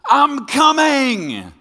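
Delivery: drawn-out high loud singsong; playing a wife who is running late, calling back in a drawn-out loud whine; mock irritated wife. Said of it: "passive-aggressive person will say".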